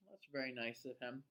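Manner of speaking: ordinary delivery, nothing marked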